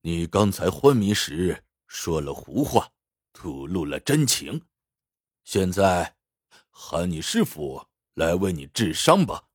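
low, controlled coldness; cold officer. A narrator's reading. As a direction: speak coolly and low, as the officer giving orders. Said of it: "鬼子大佐冷冷地说".

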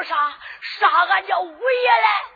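high, loud, tearful disbelief; shocked cry. Acted. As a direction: cry out in horror, pitch jumping up. Servant hears master's brother's execution. (惊叫带哭腔)

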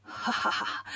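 low, soft villain's chuckle; laughing. A narrator's reading. Demon king hears of the enemy army, low scornful chuckle. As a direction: chuckle low in the throat, acted. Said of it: "笑着说".

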